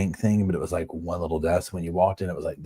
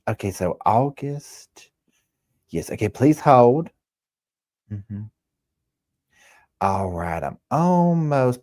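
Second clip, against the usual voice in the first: Heidi imitation; 5 semitones higher, slower than usual. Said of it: "she would be like".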